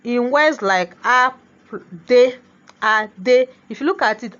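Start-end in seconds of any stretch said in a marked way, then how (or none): 1.0-3.5 "a, de" as two detached beats; syllables separated. Shown as a demonstration. Teacher showing a vowel stands alone, "a, de" said separately. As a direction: set "a" apart from "de" with a clear gap. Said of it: "a is a syllable on its own".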